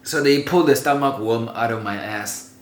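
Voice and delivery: usual speaking voice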